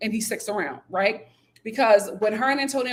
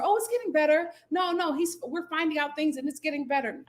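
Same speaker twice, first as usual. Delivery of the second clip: lightly voiced Raya; 6 semitones higher than usual. Lightly imitating Raya reassuring her sister. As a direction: brush it off gently. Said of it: "Raya tells her".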